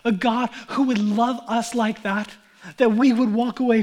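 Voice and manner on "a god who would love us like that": usual speaking voice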